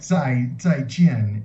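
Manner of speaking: slow, careful zai jian; slow example. Shown as a demonstration. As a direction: stretch out the phrase to model it. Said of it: "So like".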